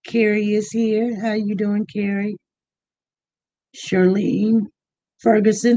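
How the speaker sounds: ordinary talk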